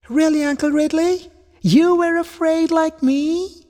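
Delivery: put-on very high child voice, wondering; amazed little rabbit. A narrator's reading. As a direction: squeak it like an astonished little one. Little rabbit amazed by uncle's confession.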